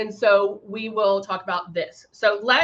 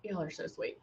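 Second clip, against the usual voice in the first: much softer, murmured thanks; hushed thanks; 7 semitones lower, 17 dB quieter than usual. Real, not acted. Thanking live viewers for their comments, a hushed murmur. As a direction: say it under your breath, touched.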